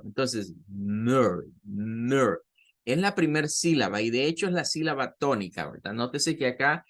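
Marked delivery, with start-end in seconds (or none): 0.7-2.4 «mer» con vocal entre U y E, más grave; sonido mezclado. Shown as a demonstration. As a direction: di «mer» bajando el tono, con la ER inglesa. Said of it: "Por ahí anda este sonido". («mer» con vocal inglesa, ni U ni E)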